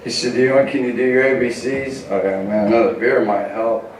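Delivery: ordinary delivery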